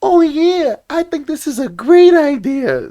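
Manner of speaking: high, loud mocking woman's voice; mocking falsetto of a delighted woman. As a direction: sing it up high like a thrilled wife. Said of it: "the woman's gonna be like".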